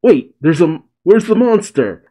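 high-pitched, stumbling startled questions; mock panicked sputtering; flustered questioning. Acting flustered Bonnie after coughing.

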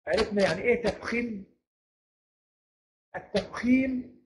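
ordinary delivery

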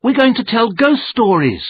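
high, loud eager announcement; a child bursting with news; thrilled young voice; excited child. A narrator's reading. Children begging for ghost stories.

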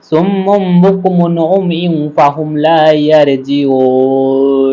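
the whole verse recited slowly, long vowels drawn out; slow recitation. Shown as a demonstration. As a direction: recite the verse in one slow chanting line. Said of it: "টেনে টেনে পড়তে হবে". (ধীর তিলাওয়াত)

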